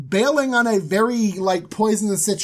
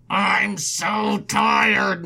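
very slow, drawn-out complaint; mock weariness; much slower than usual. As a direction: drag it out, hammed-up exhaustion. Acted quarrel, weary complaint.

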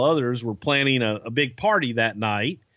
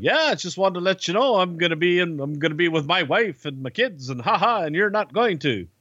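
gloating Pete; 6 semitones higher than usual. Retold or imitated. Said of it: "And Pete's like".

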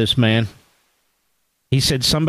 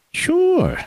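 sarcastic; 13 semitones higher than usual. Acted. Sarcastic reply to Bill Barr.

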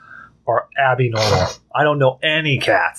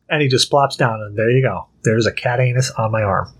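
usual speaking voice

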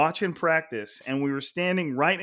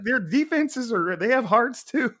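pleading protest; 9 semitones higher than usual. Acted. Host sticking up for defenses, mock-pleading. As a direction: plead the underdog's case, pitched way up.

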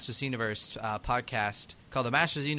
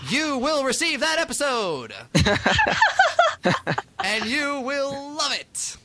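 high, loud hammed-up decree, drawn out at the end; playful booming command, slowing on the last words; mock-grand proclamation; 12 semitones higher, 7 dB louder than usual. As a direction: proclaim it like an over-the-top cartoon villain. Mock grand promise to the subscribers.

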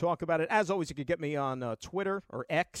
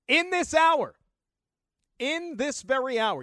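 hyped up; 9 semitones higher, 8 dB louder, slower than usual. Acted. Teasing contest hype.

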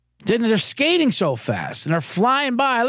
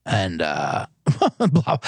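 dismissive blah; 9 semitones lower, slower than usual. Real, not acted. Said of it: "I was told not to say blah".

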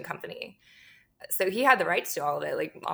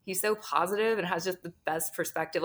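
ordinary delivery

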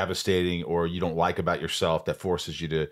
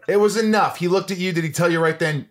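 high, loud, eager questions; excited; 9 semitones higher, 6 dB louder than usual. Real, not acted. Host thrilled by her audition story, excited and loud.

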